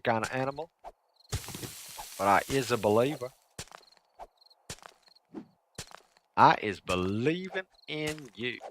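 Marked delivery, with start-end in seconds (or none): 2.2-8.6 silly voice. Real, not acted. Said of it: "I don't know why I'm talking like this".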